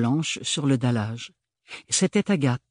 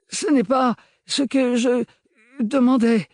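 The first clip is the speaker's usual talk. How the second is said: high, loud, forcing the words out; articulating with difficulty; 12 semitones higher, 6 dB louder than usual. Acted. Sick young man protesting, words forced out. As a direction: struggle to get each word out. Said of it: "articula-t-il difficilement".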